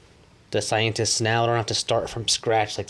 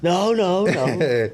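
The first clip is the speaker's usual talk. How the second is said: high, louder put-on denying voice; mock denial; 8 semitones higher, 4 dB louder, much slower than usual. Person caught lying, high mock denial. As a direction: play someone refusing to own up. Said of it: "just fucking say".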